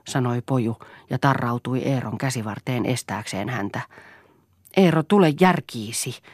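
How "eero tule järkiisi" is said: raised, mildly urgent plea; pleading. A narrator's reading. Wife begging her violent husband, pleading. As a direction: plead with him to stop. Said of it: "pyysi Lea".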